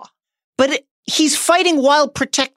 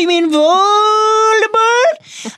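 drawn out, high sneer; slow mocking taunt; 11 semitones higher, 3 dB louder, much slower than usual. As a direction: taunt her slowly. Harry mocking slowly.

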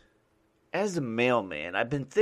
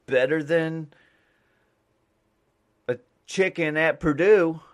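corny slogan pitch; 6 semitones higher, 7 dB louder than usual. Pretend. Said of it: "And I'm like".

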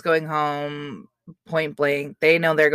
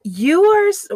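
indignant; 13 semitones higher, 6 dB louder than usual. Real, not acted. Indignant at being called sleepy.

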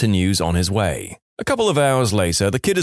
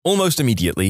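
ordinary talk, nothing marked

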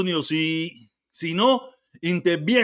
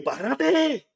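higher, insistent stop; halting a rapid-fire questioner, higher and insistent; firm interruption; 6 semitones higher, much slower than usual. Retold or imitated. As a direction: cut him off, pitched up and insistent. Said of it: "te digo".

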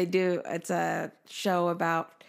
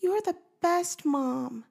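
put-on much higher, slow, soothing child voice; voicing daughter comforting; 11 semitones higher, slower than usual. Daughter comforts her crying mom, high tender child voice. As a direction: reassure Mom like a loving little girl. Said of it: "And she was like".

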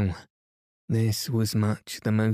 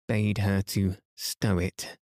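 ordinary delivery, nothing marked

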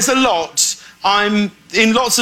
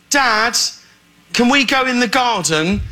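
put-on British accent; 6 semitones higher than usual. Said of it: "he talks in a British accent with me".

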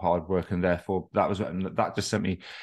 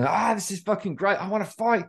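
much higher, revved-up mock manic glee; hammed-up hypomanic rush; manic excitement; 11 semitones higher than usual. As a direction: act out buzzing, fight-ready energy. Acting out his hypomanic energy.

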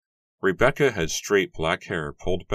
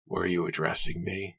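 lightly voiced gruff old man; a touch of a stern, irritable father; barking old man; 2 semitones higher than usual. A narrator's reading. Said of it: "he barked".

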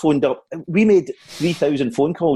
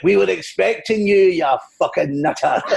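drawn-out comic greeting, starting high; Billy Connolly; 6 semitones higher than usual. Acted. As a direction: relish the insult as the comedian. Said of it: "It's, it's Billy Connolly here".

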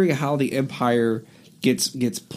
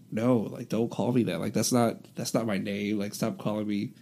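a low, soft protest; imagined quiet protest; 4 semitones lower, 7 dB quieter than usual. Pretend. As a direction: mutter it, annoyed at the old nickname. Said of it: "he's gonna be like".

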